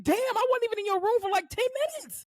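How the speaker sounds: high, indignant retold protest; mock disbelief. Therapist shocked he cussed her out, high indignation.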